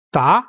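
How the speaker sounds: "Da" sliding upward; rising tone. Shown as a demonstration. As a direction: say "Da" with a rising pitch. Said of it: "going up".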